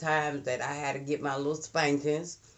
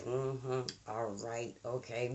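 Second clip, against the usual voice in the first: softer, grudging mm-hmm under the breath; muttered to herself; 3 semitones lower, 6 dB quieter, slower than usual. Retold or imitated. Child grumbling out of the mother's earshot, softer. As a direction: skeptical agreement kept private. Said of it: "I didn't say that to her".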